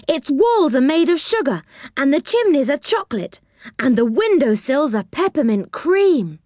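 put-on high-pitched, marvelling child; excited cry. A narrator's reading. Joe describing a house of sweets, excited and high. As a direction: list each wonder with high, eager delight. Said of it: "cried Joe".

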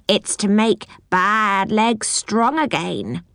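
slow, odd little goblin. A narrator's reading. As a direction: drawl it like a busy goblin. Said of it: "said the goblin, stirring hard".

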